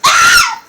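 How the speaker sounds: sudden high yelp of alarm; sharp yelp. Real, not acted. Startled yelp in nerf fight.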